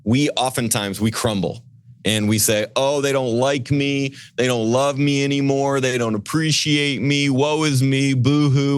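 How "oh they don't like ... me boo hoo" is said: higher, whiny mock lament; mock self-pity. Wounded staffer mocking his own self-pity, whiny. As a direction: ham up a wounded whine. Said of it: "And we start crying".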